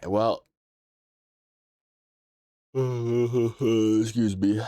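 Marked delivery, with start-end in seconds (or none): 2.7-4.6 words stretched by a yawn. Real, not acted. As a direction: say it mid-yawn. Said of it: "I'm yawning".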